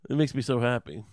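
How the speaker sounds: usual speaking voice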